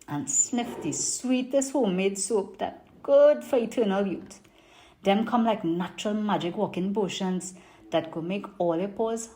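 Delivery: put-on lively Trini market vendor accent; Trinidadian accent. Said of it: "I'm gonna do it in my best Trini market accent".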